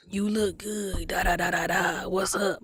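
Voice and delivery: lightly voiced creepy older man; mock barber flirting.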